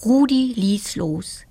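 plain reading voice

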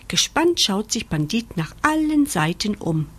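plain reading voice

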